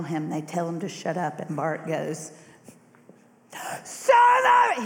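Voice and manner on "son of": high, loud desperate shout; crying out louder. Acted. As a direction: throw it over the crowd. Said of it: "he cries out louder".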